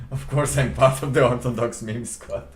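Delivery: bursting out laughing, loud and high. Real, not acted. Streamer admits to viewers he's in the meme squad, laughing loudly. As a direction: laugh it out proudly while saying it.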